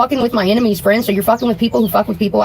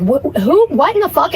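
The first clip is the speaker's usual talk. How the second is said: higher, retold shock at the call; retold disbelief; 7 semitones higher, slower than usual. Co-host retelling her shock at the late call, pitched up. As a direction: replay your own stunned reaction. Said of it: "And I'm like".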